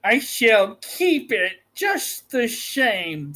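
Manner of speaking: slow, lightly laughing witch voice; mocking witch. The witch taunts Dorothy, with a hint of a laugh. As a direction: taunt her, lightly amused. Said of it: "said the witch, laughing at her".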